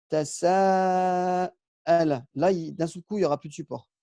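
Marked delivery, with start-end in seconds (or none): 0.1-1.5 Tasa'ala drawn out very slowly, every syllable held; slow Arabic demo. Shown as a demonstration. Teacher stretches Tasa'ala slowly for French-speaking students. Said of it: "Donc ici, regardez".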